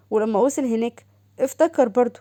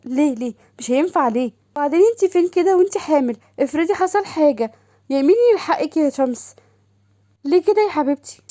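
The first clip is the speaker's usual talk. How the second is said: higher, faster, alarmed sister; worried sister; 5 semitones higher, 4 dB louder than usual. Acted. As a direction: scold her out of fear. Doha worried, scolding. (أخت قلقة بصوت أعلى وأسرع)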